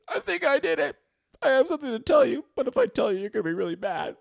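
high, loud whiny sobbing voice; mock crying. Boy confessing to mom while crying, high mock wail. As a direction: wail it like a scared kid. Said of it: "I just start crying".

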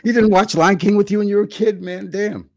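can't believe what he just heard; shocked disbelief. Real, not acted. Friend can't believe dad missed Lion King, shocked.

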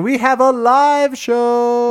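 high, loud hyped announcement; excited announcement. Acted. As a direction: hype up the live show. Hyped live show announcement.

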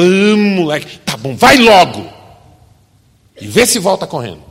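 loud, fed-up mother; exasperated giving in. Acted. Mother finally letting son go, loud and fed up. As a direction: give in with a loud groan of defeat.

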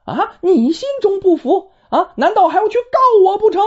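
high, sharp, fast angry retort; flaring up. Acted. Stepmother flaring up at her suspicious stepson, high and angry. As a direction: snap back at him in sudden fury. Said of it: "邵氏火了".

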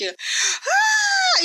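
long, very high imitated screech; dragon screech. Fan imitating the dragon at the wall, high sustained screech. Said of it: "you hear a dragon screech".

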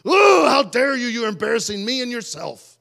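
high, loud mock outburst easing off; outraged parent. Parent scolding a child who yelled in Walmart, outraged. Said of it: "my child yells at me when I'm in Walmart".

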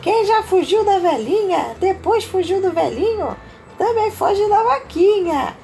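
high, loud, laughing gingerbread man; laughing taunt. Acted. Mother reading, gingerbread man mocking the cow with laughter. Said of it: "riu e falou assim".

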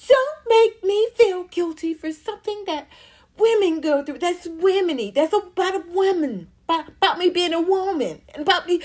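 high, teary mock whine on Instagram; mocking a crying woman. Mocking the singer defending herself online, teary whine. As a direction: whine it like a hurt celebrity. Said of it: "defend the shit".

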